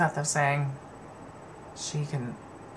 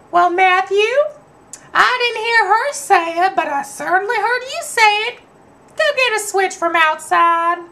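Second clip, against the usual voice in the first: grandma voice; 4 dB louder than usual. Retold or imitated. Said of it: "My grandmother goes".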